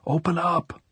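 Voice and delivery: yelling at the bars; yelled plea. A narrator's reading. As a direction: call out to be let in, voice lifted. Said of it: "hands at the bars and yelled".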